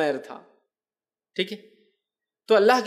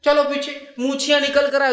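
high, loud mock scolding of a boy; harsh scolding elder; 7 semitones higher, 4 dB louder than usual. Imitating a masjid elder scolding a boy out of the front row. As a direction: bark at the child to get to the back row. Mocking elders shooing kids away.

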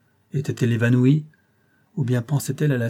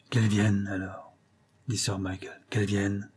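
strained voice; 2 semitones lower, 5 dB quieter than usual. A narrator's reading. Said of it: "Il parlait d'une voix comprimée".

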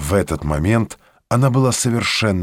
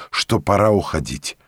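usual speaking voice

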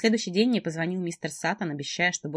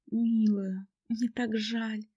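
high, gentle, sorry; sympathetic friend; 6 semitones higher, much slower than usual. A narrator's reading. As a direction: console her tenderly. Friend consoles.

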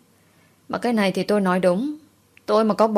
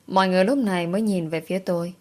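ordinary delivery

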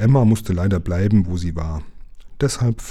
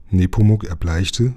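plain reading voice

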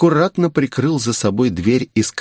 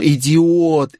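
higher-pitched, exasperated insult; furious interruption; 6 semitones higher than usual. A narrator's reading. Girl interrupting his talk of ambition, angry. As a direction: cut him off in anger. Cuts him off, eyes sparking.

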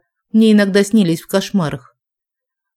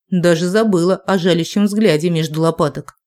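usual speaking voice

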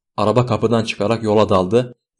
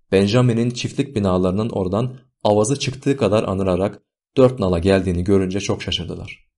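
ordinary delivery, nothing marked

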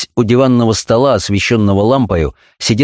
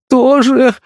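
tearful whisper. A narrator's reading. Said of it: "сквозь слёзы прошептал мальчуган".

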